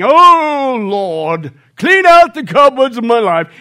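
slow, loud, pious British-tinged prayer voice; pompous deacon prayer. Retold or imitated. Old deacon ending the prayer meeting, slow and loud. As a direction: pray grandly like a puffed-up deacon. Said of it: "he starts his thing".

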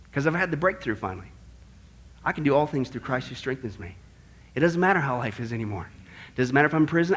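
soft, low, unhurried acceptance; quiet, grateful contentment; contented humility. Acted. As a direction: say it gently, low and at peace. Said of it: "but he didn't. He says".

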